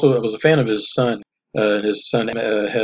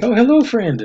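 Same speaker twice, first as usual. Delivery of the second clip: put-on high-pitched, loud Russian-accented greeting; playful mock Russian accent; 13 semitones higher, 5 dB louder than usual. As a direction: imitate a friendly Russian-accented hello. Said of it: "do it in a Russian accent".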